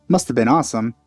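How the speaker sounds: normal talking voice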